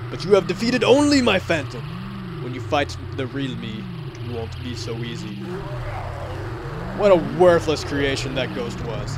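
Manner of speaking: a hint of a menacing Ganondorf. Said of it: "the voice I gave to Ganondorf".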